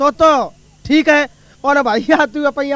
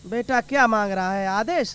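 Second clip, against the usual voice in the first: lightly voiced mother, a bit lower and softer; mother answering her son, a touch lower; mother's voice; 5 semitones lower, 8 dB quieter than usual. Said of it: "ओ री अम्मा".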